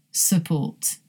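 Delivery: "support" softly as suh-PORT; schwa first syllable. Shown as a demonstration. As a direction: say "support" with a reduced first vowel. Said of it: "Here's one more".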